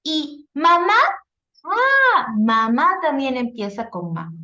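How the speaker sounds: put-on a child suddenly noticing the same sound; child's discovery. Teacher voicing a child who finds matching syllables, delighted.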